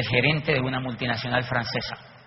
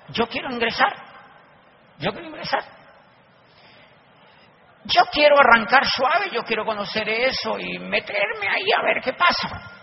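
cautious eagerness; 9 semitones higher than usual. Retold or imitated. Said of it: "Así con esa actitud".

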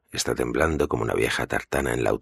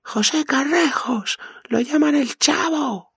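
high, loud desperate screaming; 5 dB louder, slower than usual. Acted. Mikey spills everything to Keller, screaming in panic. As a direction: keep screaming it out as you break. Mikey breaking under torture.

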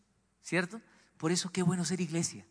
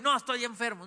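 mock stubborn denial; 6 semitones higher than usual. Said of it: "Está enfermo", y".

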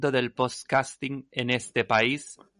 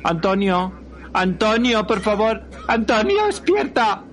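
shrill panicked calls, much higher; panicked shouting; 11 semitones higher, 5 dB louder than usual. Acted. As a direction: scream his name in panic. Calling unresponsive husband. (gritos de pánico)

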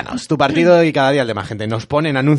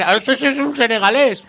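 a lightly higher put-on voice; 8 semitones higher than usual. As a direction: say it in a silly put-on voice. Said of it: "Si llego a decirlo con esta voz".

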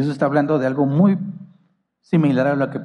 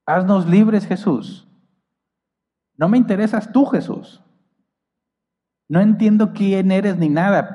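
loud, higher pleading demand; demanding crowd; 6 semitones higher, 4 dB louder than usual. Acted. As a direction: shout it like a selfish crowd. Voicing the crowd's selfish demand.

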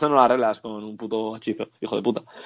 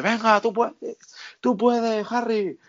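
mock cheering crowd; 9 semitones higher, slower than usual.

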